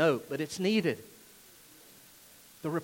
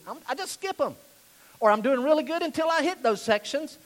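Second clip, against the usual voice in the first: higher-pitched mock excuse; imagined churchgoer excusing himself; 8 semitones higher, 4 dB louder than usual. Listener admitting to skipping boring sections, higher and defensive. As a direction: lift the voice into a listener's lame justification. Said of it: "you're sitting there and you're thinking".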